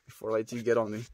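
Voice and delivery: ordinary talk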